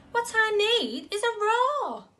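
high, loud, squeaky mouse voice full of resolve; a tiny mouse boldly declaring his plan; eager little mouse. Acted.

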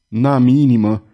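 usual speaking voice

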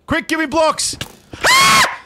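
high loud urgent cry; panicked shout. Real, not acted. Trapped without blocks, panicked shout. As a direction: yell for help in panic.

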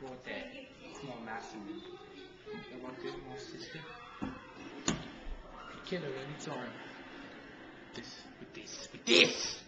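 whispering. Acted. Hushed stalking narration.

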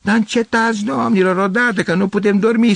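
high, loud neighbour's yell; sleepless, fed-up outcry; exasperated shout. A narrator's reading. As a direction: yell at noisy people to stop. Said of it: "strigă cineva".

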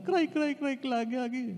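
high teasing sing-song cry, cry; mock whiny cry-cry. Mimicking crying pastor mother. (kunwaring umiiyak na boses, mataas)

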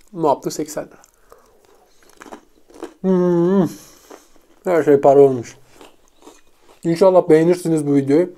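talking while chewing; eating a pizza bite; mouth full. Real, not acted. Seen 2: Puts pizza bite in mouth at 0.2–1.2, then chews while talking.

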